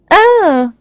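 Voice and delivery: high, drawn-out bored "oh". A narrator's reading. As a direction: show lofty disinterest in a high voice. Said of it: "sounding bored".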